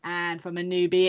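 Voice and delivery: ordinary talk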